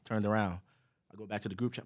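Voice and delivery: normal talking voice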